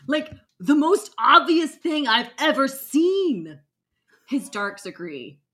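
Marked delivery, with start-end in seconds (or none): 0.1-3.6 loud, higher, emphatic exasperation; exasperated outburst. Acted. Squat wizard carries on at the clueless narrator, loudly exasperated. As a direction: hammer it home loudly, in disbelief.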